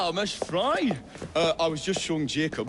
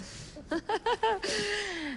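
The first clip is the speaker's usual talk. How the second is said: high cackling laugh; 3 dB quieter than usual. Acted. Bell laughs at Fry's wit.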